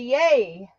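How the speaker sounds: ordinary delivery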